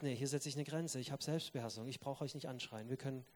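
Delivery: soft, calm firm voice. Retold or imitated. A family member refusing to shout, calm and soft. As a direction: set the boundary quietly and calmly. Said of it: "der sagt".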